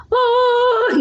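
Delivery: very high sung oh; high-pitched demo oh. Shown as a demonstration. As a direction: strain up to a high oh. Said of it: "if you wanna get a high tone".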